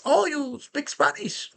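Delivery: high, slow mock Colombian accent; drawn-out high imitation of a Colombian hustler. Hustler surprised the speaker speaks Spanish, slow Colombian accent. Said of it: "it's like".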